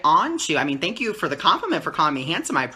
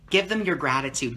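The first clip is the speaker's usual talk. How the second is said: normal talking voice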